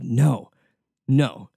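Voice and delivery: ordinary talk, nothing marked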